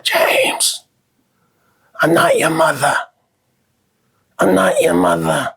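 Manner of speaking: a dying mother's shocking reveal; dying mom voice. Retold or imitated. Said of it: "my mom's on her deathbed and she's like".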